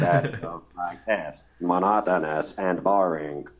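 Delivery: slow monotone robot voice; flat, droning robot drone. Retold or imitated. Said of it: "just a simple robotic voice".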